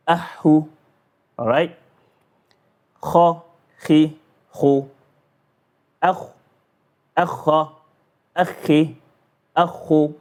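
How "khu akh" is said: usual speaking voice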